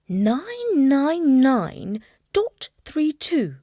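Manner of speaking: slow, careful reading of numbers; reading out slowly. Acted. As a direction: spell out each digit slowly. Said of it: "she read out slowly".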